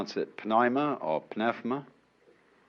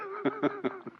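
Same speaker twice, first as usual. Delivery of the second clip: quiet, quick tittering laugh; soft chuckle; 10 dB quieter than usual. Real, not acted. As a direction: chuckle softly at your own joke. Amused at his own pun.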